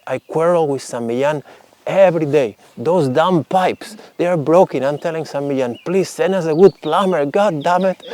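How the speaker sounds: hammed-up angry old priest complaining; grumbling rant. Old priest rants to the saint about broken pipes, mock exasperated. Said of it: "his demeanor totally changed".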